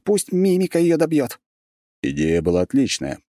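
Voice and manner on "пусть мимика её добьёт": high, urgent orders; urgent commands. Acted. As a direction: hurry them along. Tami urgently giving orders.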